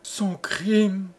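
high, stunned question; high-pitched dazed horror; bewildered stammer. A narrator's reading. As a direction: echo it in disbelief, losing your mind. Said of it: "Balbutia l'infortuné dont la raison".

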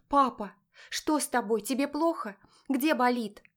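alarmed daughter. A narrator's reading. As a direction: cry out to a father in pain. Daughter rushes to stricken father.

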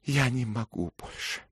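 breathless exhausted cry; out of breath and spent. A narrator's reading. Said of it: "задыхаясь".

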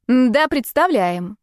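slow, pointed reply at full voice; not whispered at all. A narrator's reading. Voice behind the door answers the pranksters, pointedly aloud. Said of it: "совершенно не шёпотом ответил голос".

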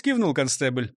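ordinary delivery

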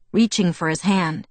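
usual speaking voice

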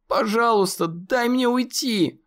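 high, pleading wail; terrified wail. A narrator's reading. Conor begging the monster, terrified. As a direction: beg to be let go, panicked. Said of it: "завопил Конор".

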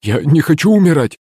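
высокий, чуть громче, в панике; закричи от страха смерти; испуганный крик. A narrator's reading. Бармен боится смерти. (панический крик бармена)

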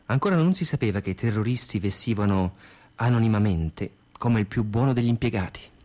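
normal talking voice

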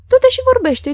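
loud urging. Acted. Mr. Omer urging the young guest to go talk, loud and eager. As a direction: call out an eager invitation.

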